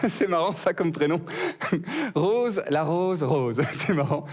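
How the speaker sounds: chuckling through a cheesy chat-up line; flirty laughing. Acted. Comic flirting with the rose, laughing through it. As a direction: laugh along at your own pun, slowly.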